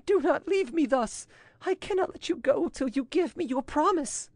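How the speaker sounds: high-pitched, imploring woman; desperate pleading. A narrator's reading. Woman clings to departing commander, desperately pleading. Woman begs him to stay.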